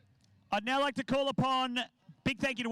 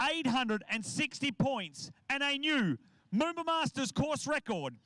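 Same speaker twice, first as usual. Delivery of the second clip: announcer; 3 semitones higher than usual. Acted. Announces award winners.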